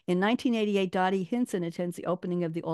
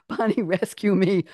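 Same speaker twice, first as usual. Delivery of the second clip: ordinary delivery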